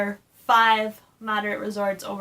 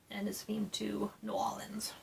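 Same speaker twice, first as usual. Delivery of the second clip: whispered aside; whispering; 13 dB quieter than usual. Real, not acted. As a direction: drop to a whisper. Unvoiced whisper.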